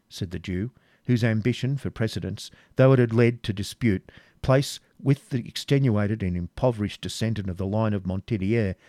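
ordinary delivery

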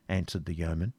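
ordinary delivery

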